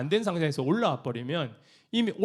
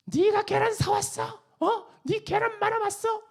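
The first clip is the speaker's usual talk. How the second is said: indignant kitchen volunteer. Retold or imitated. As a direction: fire back at the complainer in outrage. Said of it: "이렇게 하면".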